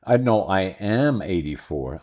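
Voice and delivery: ordinary delivery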